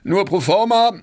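loud announcement. A narrator's reading. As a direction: announce it loudly to both parties. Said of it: "was er selbst laut verkündete". (laut verkündend)

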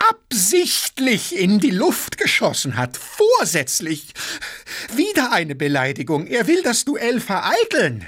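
high-pitched, outraged accusation; croaking indignation. A narrator's reading. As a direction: hammer out the charge against your opponent. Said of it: "krächzte Gaganow". (empört krächzend)

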